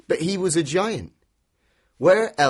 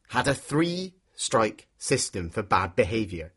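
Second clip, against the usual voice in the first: ordinary delivery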